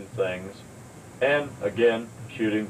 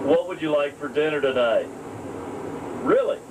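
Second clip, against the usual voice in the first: a touch higher, chirpy domestic chatter; lightly voiced cheerful partner; cheerful pretend chat; 5 semitones higher than usual. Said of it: "start a tape something like this".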